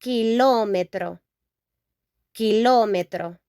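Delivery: kilómetro with stress on the ló, rolled r; teacher modelling kilómetro; Spanish pronunciation. Shown as a demonstration. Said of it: "teacher models Spanish word".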